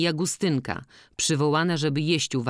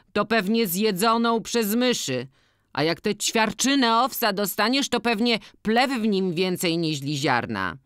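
higher-voiced villager airing grievances; organist, grumbling; 5 semitones higher than usual. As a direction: complain animatedly, lifting the pitch above your own. Voices a character complaining. (podwyższony głos narzekającego organisty)

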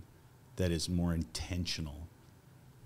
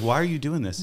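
higher, louder quizzing; raised-pitch, insistent question; retold puzzled question; 8 semitones higher, 5 dB louder, faster than usual. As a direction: re-enact asking a friend why. Retelling his question to Bill.

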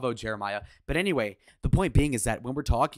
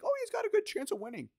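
far higher squeaky mock voice; mocking naive fan; faster than usual. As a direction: chirp it like a clueless believer. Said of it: "unironically say".

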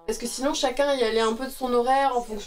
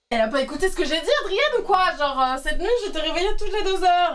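mock outrage; 6 semitones higher than usual. Mock indignation at the chat.

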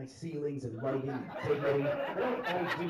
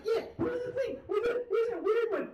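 high-pitched, pleading stage voice; strained, pitched-up mock stage patter; struggling comic; 4 dB louder than usual. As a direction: imitate a bombing comic pressing on over hecklers. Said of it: "and he's trying. He's like".

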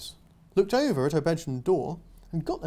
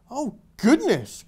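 slow, louder, higher gasp; shocked aardvark; 7 semitones higher, 6 dB louder, slower than usual. Acted. Aardvark hearing the girl is lost, dismayed. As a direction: cry out in dismay like a fussy aardvark. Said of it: "cried the aardvark".

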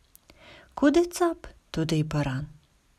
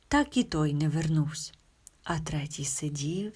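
ordinary delivery, nothing marked

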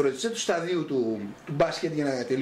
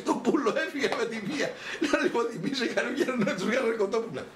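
laughing while telling; 6 semitones higher than usual. Real, not acted. Laughing while telling funny story.

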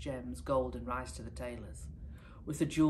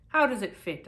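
polite tailor question; 7 semitones higher, 10 dB louder than usual. Acted. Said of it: "asked the young tailor".

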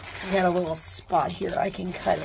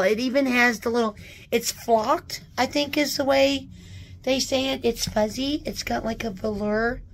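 usual speaking voice

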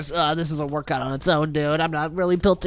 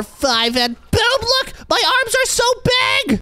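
excited high squeal; slower than usual. Acted. Boasting about huge arms.

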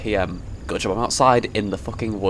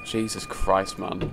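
ordinary talk

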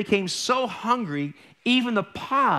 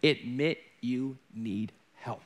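solemn emphasis; 7 semitones lower, 6 dB quieter, slower than usual. Shown as a demonstration. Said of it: "number one".

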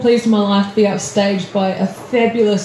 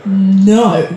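one drawn-out, hesitant no; wary refusal; 3 semitones lower, 3 dB louder than usual. Acted. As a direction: refuse slowly after a long pause. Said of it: "And you go".

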